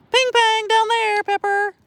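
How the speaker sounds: squeaky high dog call; high playful call. Real, not acted. Owner to dog, squeaky high playful call.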